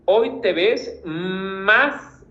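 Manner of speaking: "Hoy te ves más" very slow, más stretched; emphatic, drawn out. Shown as a demonstration. Teacher reads a student's emphatic line, slowly. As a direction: drag each word for emphasis. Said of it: "para sustituir los signos de admiración".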